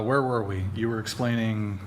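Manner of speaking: ordinary talk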